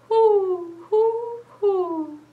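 high hooting owl. Retold or imitated. Librarian hooting like an owl for the kids. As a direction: hoot like an owl. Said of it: "A snowy owl is what I found".

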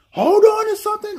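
high, loud movie warning; mock shout. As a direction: call it out urgently. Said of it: "for a character to say".

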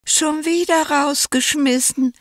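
sehr hoch und weinerlich; jammernd. A narrator's reading. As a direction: klagend quengeln. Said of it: "jammerte der Kurze". (sehr hoch jammernd)

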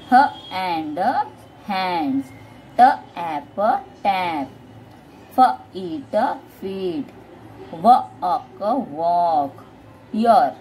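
letter sounds said apart with pauses, as in "f, eat", then "feed" whole; phonic sounding-out. Shown as a demonstration. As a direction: sound out "t, ऐप" in pieces, then blend into "टैप". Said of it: "फोनिकली बच्चों को सिखा रहे हो".

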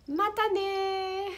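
high, loud, drawn-out farewell; cheery sign-off. Real, not acted. Teacher signing off to lesson followers, cheery high call-out. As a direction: call goodbye out high, holding the last vowel. Playful sing-out goodbye to viewers.

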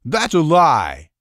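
loud, slow, high indignant shout. Acted. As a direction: throw the accusation back at him. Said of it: "the tub of a man shouted".

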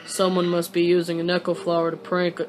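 low, laid-back character voice; game character voice. Acted. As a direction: read it low and level, in character.

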